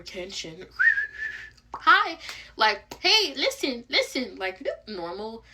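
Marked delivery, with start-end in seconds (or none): 1.7-4.4 tic voice. Retold or imitated. Imitating a tic outburst.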